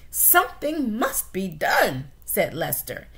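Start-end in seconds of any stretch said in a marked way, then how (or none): 0.1-2.1 slow, loud pronouncement; grave father mouse. Acted. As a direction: declare it slowly and heavily.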